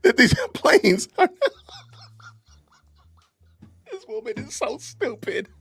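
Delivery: high, crying with laughter; words breaking into laughter; laughing uncontrollably. Real, not acted. Host reacting to plane theory, crying with laughter. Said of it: "I can't even say this with a straight face".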